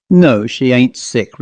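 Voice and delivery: ordinary delivery, nothing marked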